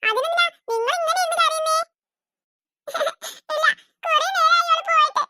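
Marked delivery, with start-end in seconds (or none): 2.9-3.5 short, soft tearful sob; a child's quiet sob. Acted. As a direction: catch a sob in the throat. Children crying, sob between lines.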